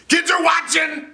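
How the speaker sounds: very high shouted line; mock shout for comic effect. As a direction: yell it for effect. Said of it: "I did that for the effect".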